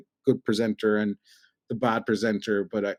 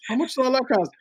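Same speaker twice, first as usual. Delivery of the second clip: mock wife; 11 semitones higher than usual. Voicing wife's complaint.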